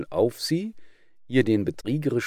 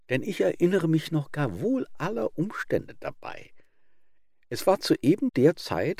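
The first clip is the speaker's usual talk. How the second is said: sly fox; 7 semitones higher than usual. Acted. Fox mockingly interrupting the wolf.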